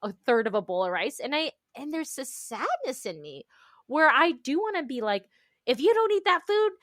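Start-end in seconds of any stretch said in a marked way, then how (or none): 5.7-6.7 higher, louder half-joking threat; mock threat. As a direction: play the strict elder, lightly. Voicing threat to child.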